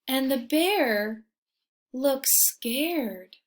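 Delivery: lively storybook reading; reading to children. A narrator's reading. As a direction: read it like a bedtime picture book. Reading picture book aloud.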